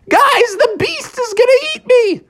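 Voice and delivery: very high frantic squeal; panicked Froggy shriek. A narrator's reading. Froggy caught in the beast's mouth, panicked shriek. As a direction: squeal for help in cartoon terror.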